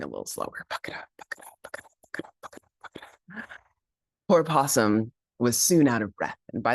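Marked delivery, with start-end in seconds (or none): 0.0-0.7 whisper. A narrator's reading. Slowing narration. 0.7-3.4 whispered running. Acted. Possum slowing down.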